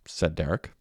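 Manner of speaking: plain reading voice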